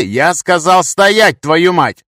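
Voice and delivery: loud, high, angry bark; barked order. A narrator's reading. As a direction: bellow the command, stretching it hard. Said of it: "рявкнул я".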